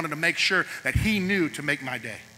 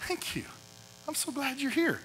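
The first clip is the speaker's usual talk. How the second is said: kind greeting; 6 semitones higher, 13 dB quieter than usual. Retold or imitated. Peer greeting a newcomer kindly.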